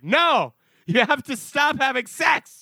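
loud shouting director; director yelling. Retold or imitated. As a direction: yell at them to stop. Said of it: "the director was like".

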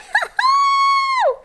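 very high shrieked laugh; shrieking laugh. Pretend. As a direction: burst into a squealing laugh. Peak of mock song.

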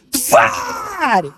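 very high, shouted «Тварь». Retold or imitated. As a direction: bellow the last word. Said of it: "Громко орал".

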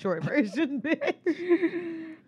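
helpless laughter breaking up the words; laughing protest. Real, not acted. Host calls out guest's short version, laughing in protest. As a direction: say it through real laughter.

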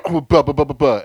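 waving away their talk; dismissive blah blah. Retold or imitated. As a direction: brush it all off as noise.

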